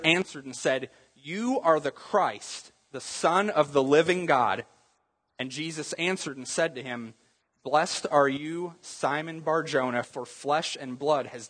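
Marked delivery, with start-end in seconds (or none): none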